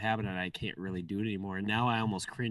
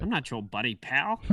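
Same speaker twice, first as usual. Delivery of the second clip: lightly imitated cartoon retort, rising higher and louder; half-hearted quote impression, pitched up on the last word; South Park; 6 semitones higher, 5 dB louder than usual. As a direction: do a rough impression of a cartoon comeback. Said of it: "or a good impression".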